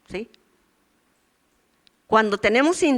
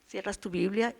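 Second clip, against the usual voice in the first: usual speaking voice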